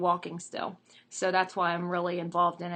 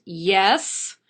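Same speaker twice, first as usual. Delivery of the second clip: louder, irritated reply; testy, raised-voice response; a bit irritated; 9 dB louder than usual. Acted. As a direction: answer as if losing patience. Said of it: "a little bit more irritated".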